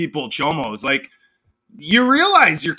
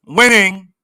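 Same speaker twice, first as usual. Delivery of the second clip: loud gloat; 7 dB louder than usual. Real, not acted. Gloating one-word taunt.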